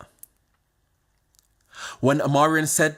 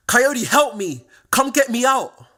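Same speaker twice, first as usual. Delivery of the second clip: high, loud, slow desperate plea of a younger sister; pleading sister; 10 semitones higher, 4 dB louder, slower than usual. Retold or imitated. Younger sister calls her brother from a police cell, loud high desperate plea. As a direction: cry out for help, stretching the words, in a sister's voice.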